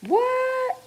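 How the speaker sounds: high startled what; surprised what. Real, not acted. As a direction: react in disbelief. Surprised by comment.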